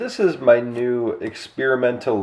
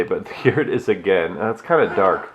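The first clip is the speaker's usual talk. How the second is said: normal talking voice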